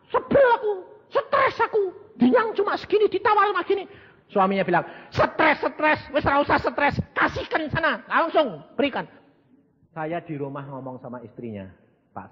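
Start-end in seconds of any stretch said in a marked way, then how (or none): 0.1-3.9 high, whining complaint with a teary laugh; whiny rich lady. Acted. Rich woman whines to her husband on the phone, high. 5.1-9.1 higher, brushing-off reply; lightly voiced husband waving it off; voicing the husband. Husband tells his wife on the phone to hand it over.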